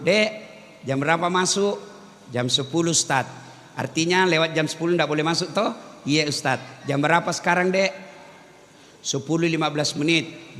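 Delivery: soft, unhurried questioning of a late student; calm patient lecturer. Retold or imitated. Lecturer calmly questions a late student, soft and patient. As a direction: gently reason with the latecomer, no anger. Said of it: "Nggak marah dia".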